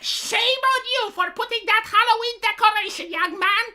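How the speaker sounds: put-on high, loud scolding old neighbor; hammed-up finger-wagging scold; mock scolding. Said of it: "you need to have a neighbor going like".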